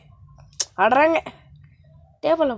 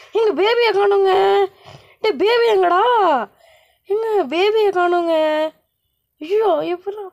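panicked; 6 semitones higher than usual. Acted. Baby missing, panicking.

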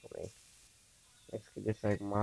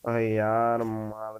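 louder exasperated yell; loud groan of despair; loud frustrated cry; 7 dB louder, much slower than usual. Real, not acted. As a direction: shout in defeat. Killed in game.

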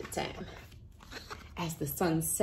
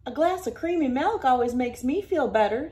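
higher, louder Country Carl; lightly voiced dairy farmer; friendly farmer; 5 semitones higher, 5 dB louder than usual.

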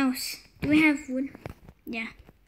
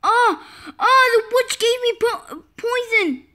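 high, louder alarmed cry; panicked; 6 semitones higher, 4 dB louder than usual. Real, not acted. Player poisoned by the witch, high panicked outcry. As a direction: blurt out the bad news in panic.

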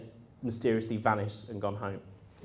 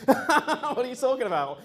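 high incredulous laugh through the words; laughing in disbelief at the trainer; laughing retort; 11 semitones higher, 4 dB louder than usual. Retold or imitated. To the trainer, a high laughing disbelief.

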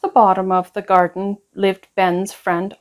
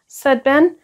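usual speaking voice

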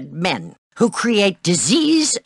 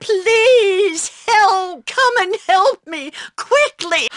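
frightened plea for help; 11 semitones higher, slower than usual. Acted. Pleading for help.

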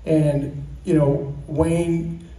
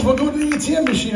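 much higher imitated wife, quick and brisk; mock wife voice; 10 semitones higher, faster than usual. Husband imitating his wife's quick reply in a higher voice. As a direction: squeak it out fast as the wife. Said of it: "She goes".

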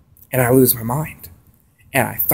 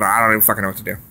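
normal talking voice

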